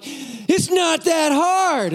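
high, very drawn-out cheer; hollering. Retold or imitated. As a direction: stretch each word out to the climber. Dad hollering to child overhead.

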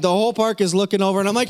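ordinary talk, nothing marked

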